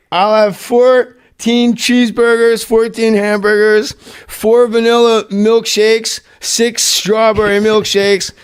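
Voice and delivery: Farley ordering. Retold or imitated. Farley's huge drive-thru order.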